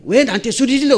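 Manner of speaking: lightly acted toddler's reproach; indignant little son. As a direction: complain back like an affronted small boy. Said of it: "집에서 한국말을 써도 말이 좀 서툴렀어요".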